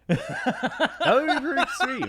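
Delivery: high, laughing through the words; real crack-up while talking. Real, not acted. Cracking up at co-host's child voice.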